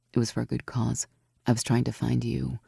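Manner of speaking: Aurora, mischievous. A narrator's reading. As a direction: drop low and sound smug about the climb.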